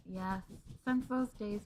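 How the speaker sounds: ordinary delivery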